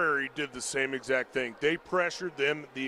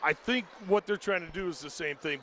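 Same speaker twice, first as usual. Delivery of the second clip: ordinary talk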